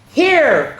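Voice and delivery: high, loud call from the cave. Acted. Little Bear calls to Daddy from the cave, high and loud. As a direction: call out from a distance. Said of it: "called Little Bear".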